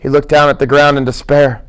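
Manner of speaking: usual speaking voice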